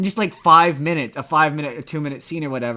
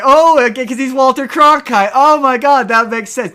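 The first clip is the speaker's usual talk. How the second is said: much higher and louder, excited realisation; sudden realisation; 9 semitones higher, 10 dB louder than usual. Retold or imitated. Retelling getting the joke as an adult, loud excited realisation. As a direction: burst out as it finally clicks. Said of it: "I'm like".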